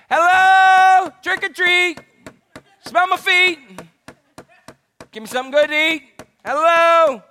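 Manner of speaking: put-on loud high kid at the door; hammed-up trick-or-treat chant, shouted high; trick-or-treater.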